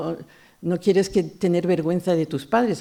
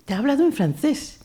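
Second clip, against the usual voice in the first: higher voice of a mother who just realized; the mother who finally gets it, voiced higher; mother explaining; 8 semitones higher than usual. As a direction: play the mother catching on, a little higher.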